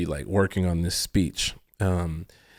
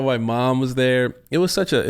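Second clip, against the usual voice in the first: normal talking voice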